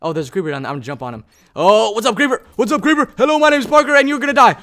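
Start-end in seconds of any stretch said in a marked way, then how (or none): 1.5-3.1 high, loud taunting yell at the creeper; hyped taunt. Acted. Gamer yells a taunt at a creeper. 3.2-4.5 high, loud hammed-up swordsman challenge; mock duel challenge. Player parodies a revenge vow to a creeper, loud.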